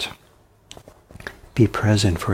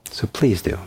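ordinary talk, nothing marked

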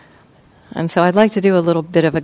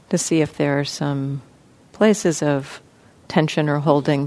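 soft, even voice with long pauses; guided meditation; 6 dB quieter than usual. Real, not acted. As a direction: gently guide listeners into relaxing, leaving space between phrases. Leads a guided body-scan meditation.

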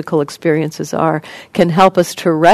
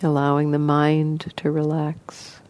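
soft, even voice with long pauses; guided meditation; 8 dB quieter than usual. Acted. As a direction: gently guide listeners into relaxing, leaving space between phrases.

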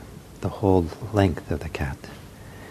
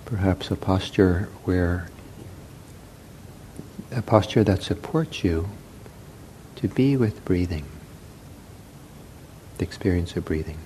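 soft, unhurried meditation voice with long pauses; guided meditation; 2 semitones lower, 8 dB quieter than usual. Real, not acted. Leads a breathing meditation.

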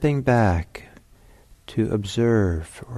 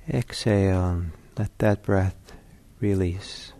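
guided meditation. Acted. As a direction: talk them gently into stillness.

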